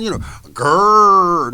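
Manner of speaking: slow, loud drawn-out growl; growl imitation. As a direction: growl it out like a dog. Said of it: "when we go for the growling".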